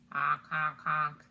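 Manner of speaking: loud honking goose. Acted. Teacher imitating geese in the story. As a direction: honk like geese flying south. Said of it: "say the geese".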